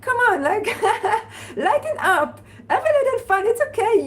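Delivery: high-pitched, laughing through the words; laughing encouragement. Real, not acted. Host telling viewers to have fun, laughing encouragement. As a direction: coax them playfully, with a laugh.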